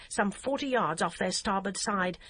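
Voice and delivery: usual speaking voice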